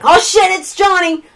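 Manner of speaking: high-pitched alarmed exclamation; acted flustered outburst; retold panic. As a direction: blurt it in mock alarm. Family panics at doorbell.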